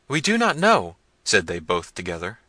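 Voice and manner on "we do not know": high, loud helpless wail; frightened servants. A narrator's reading. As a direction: answer your master high and flustered.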